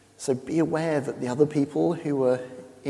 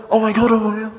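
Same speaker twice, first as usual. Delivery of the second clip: loud, high mock horror; mortified shriek; 8 semitones higher, 10 dB louder than usual. Americans learning what pants means in England, mortified. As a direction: burst out in hammed-up embarrassment, much louder and higher.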